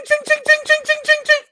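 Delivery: high, loud chinging sound; dog tag jingle imitation. Imitating the dog's collar jingling loudly. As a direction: mimic the rattling tags. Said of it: "I hear ching".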